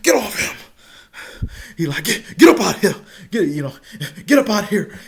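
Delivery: loud, high barking orders; drill instructor yell. Retold or imitated. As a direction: haul the fighter away. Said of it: "He pulled me off".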